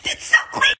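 breathy whisper of satisfaction. Real, not acted. Vlogger whispering to viewers over her clean room. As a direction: hush it like a secret reveal.

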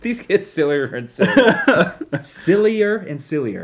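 slow, laughing delivery. Real, not acted. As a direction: drawl it out through laughter. Laughing at the tagline.

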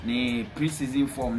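normal talking voice

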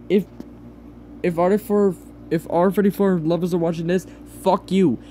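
ordinary delivery

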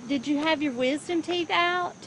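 usual speaking voice

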